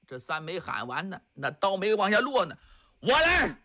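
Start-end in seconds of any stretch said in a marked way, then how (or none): 3.0-3.6 sudden shout. Acted. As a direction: burst in with a shout. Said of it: "突然有人喊了一嗓子".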